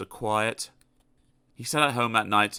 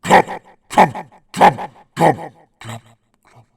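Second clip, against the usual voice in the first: fading stomping footsteps; 5 semitones higher, 10 dB louder, slower than usual. Acted. Footsteps dying away.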